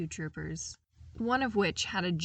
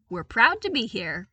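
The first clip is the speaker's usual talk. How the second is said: proud unison; 4 semitones higher, 10 dB louder than usual. Acted. Two troopers reporting in unison.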